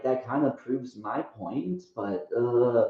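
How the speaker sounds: ordinary talk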